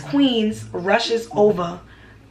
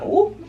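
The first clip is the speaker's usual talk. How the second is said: high squeal; 12 semitones higher than usual. Retold or imitated. High-pitched exclamation while giggling.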